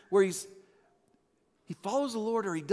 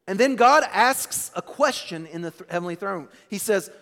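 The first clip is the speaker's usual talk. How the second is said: usual speaking voice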